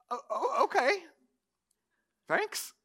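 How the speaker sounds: hesitant, high-pitched stammer; halting, unsure little high voice; baffled paralytic. Acted. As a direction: play a puzzled man politely accepting. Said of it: "imagine if you're the paralytic, and you're like".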